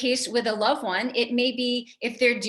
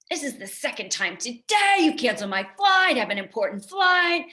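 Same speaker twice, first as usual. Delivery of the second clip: retold angry yell; 4 semitones higher, 4 dB louder than usual. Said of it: "starts yelling at her".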